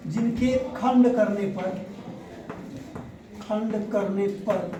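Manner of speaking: slow, measured dictation with long gaps; dictating. Real, not acted. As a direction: dictate slowly for students to write. Teacher dictating a definition for notes.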